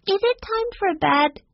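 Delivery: put-on very high-pitched small child voice; little girl's question. A narrator's reading. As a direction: ask like a tiny girl. Small girl asking at bedtime.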